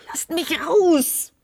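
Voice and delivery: high, drawn-out desperate cry; panicked scream. Acted. Lena screams at the dome in panic, desperate. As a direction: cry out to be let out.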